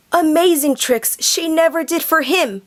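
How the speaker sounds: usual speaking voice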